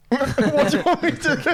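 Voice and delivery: high-pitched, laughing incredulity; baffled and cracking up; laughing retelling. Retold or imitated. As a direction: ask it through giggles. Retold baffled reply, laughing.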